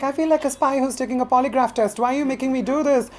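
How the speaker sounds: high-pitched comic complaint; acted exasperation; indignant protest. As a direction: whine it as an aggrieved objection. Acted protest at doctor visit.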